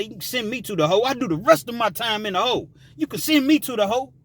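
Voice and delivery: hurried mock defiance; reckless bravado. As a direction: brag fast, daring the guards. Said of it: "penitentiary dumb lingo".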